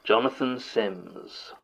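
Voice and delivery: drawn-out, falling, portentous name reveal; podcast persona. Acted. Actor reintroduces himself to fans as his character, in a portentous voice. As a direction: announce the name slowly, with mock grandeur. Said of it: "you probably know me better as".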